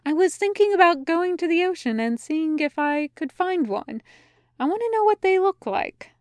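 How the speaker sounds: high, light, enthusiastic voice; bright-voiced enthusiasm; eager. A narrator's reading. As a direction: share a pet plan, pitch lifted. Angel shares eager whale plan.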